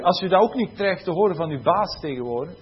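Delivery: usual speaking voice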